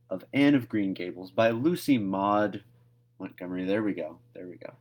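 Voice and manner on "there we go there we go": plain reading voice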